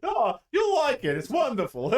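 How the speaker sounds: high, loud, laughing showman; Rip Taylor. Acted. Ammo seller hyping joke bullets, Rip Taylor impression. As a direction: whoop it up, gleefully over the top. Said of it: "bullets from Rip Taylor".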